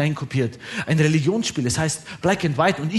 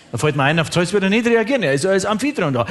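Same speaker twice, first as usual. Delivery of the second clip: usual speaking voice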